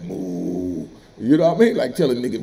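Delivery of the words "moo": cow moo. Retold or imitated. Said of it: "he did one of these joints like".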